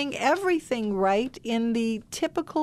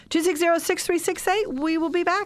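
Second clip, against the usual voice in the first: bright, raised on-air phone number read; radio announcer; 8 semitones higher than usual. Acted. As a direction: pitch the number like a radio host. Reading out station phone number.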